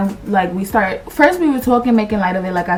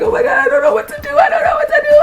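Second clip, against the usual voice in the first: very high, rushed wail; mock tearful panic; much faster than usual. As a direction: re-enact a helpless crying panic. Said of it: "I'm crying".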